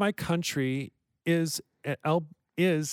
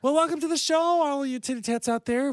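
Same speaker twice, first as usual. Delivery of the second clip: much higher, bright welcome; show host welcome; 11 semitones higher, faster than usual. Acted. Host welcomes the titty tats audience, hammed-up and high. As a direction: greet the viewers like a showy host.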